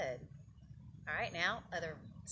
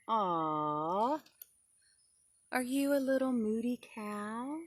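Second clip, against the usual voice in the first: higher, cooing aw; a touch of teasing sympathy; 5 semitones higher, 8 dB louder, slower than usual. Acted. Mom softening toward her moody son, cooing. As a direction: coo at him like a sorry mom. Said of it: "And then she said".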